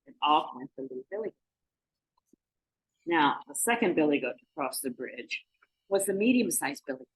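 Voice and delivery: ordinary delivery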